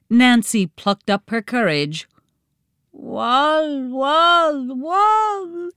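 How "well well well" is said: put-on high babbling baby sounds; baby babble. A narrator's reading. Baby Max babbling to his siblings, high and babyish. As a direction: babble like a toddler wanting a treat. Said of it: "babbled the baby".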